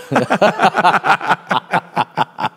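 high-pitched laughing fit. Real, not acted. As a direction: giggle at himself. Laughing at own accent.

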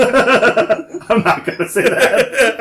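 slow, high, laughing refusal. Real, not acted. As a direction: laugh through the words. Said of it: "Can you say it in merman voice".

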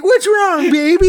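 high cooing to a tiger; cooing baby talk. Retold or imitated. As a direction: coo it like to a pet. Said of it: "He's like".

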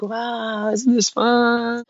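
slow, high, cheery and naive; mock-innocent delight; naive cheer. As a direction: drag it out like a clueless happy tourist. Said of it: "And I'm just like".

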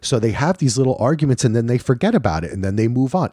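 usual speaking voice

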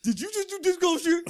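very high, hammed-up disbelief; badly faked squeaky voice of a shocked friend; bad mocking impression. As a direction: put on a high voice to mimic someone scandalized. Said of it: "that's a bad impression".

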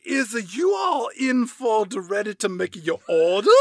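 put-on butcher a Southern accent as a waitress. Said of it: "That is a great [name] impression".

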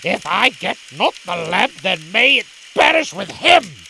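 loud, furious curse to himself; vengeful outburst at full voice; enraged dervish. Acted. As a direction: build in force to the final words. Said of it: "This enraged the dervish to a point beyond control".

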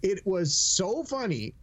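ordinary talk

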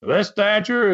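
high, stretched mock scream; mock outraged wrestler's cry; Don Carson screaming. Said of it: "Carson began to scream again".

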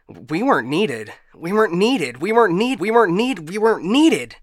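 stuck record spiralling into mania; manic realization. Acted. AI realizing it is obsolete, manic and glitching.

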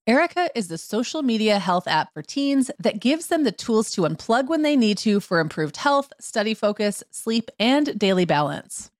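polished, evenly paced podcast ad; sponsor ad read. Acted. Host to listeners about a sponsor, ad read. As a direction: read it as a scripted sponsor message.

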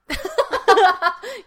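loud, high cackling laugh; real burst of laughter. Real, not acted. Listener cracks up at the co-host's trail-running rant, laughing loudly.

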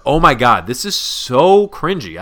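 loud groan with a drawn-out "so"; appalled cringing. Acted. Friend reading an embarrassing email, appalled cringing. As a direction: recoil from it, stretching the words.

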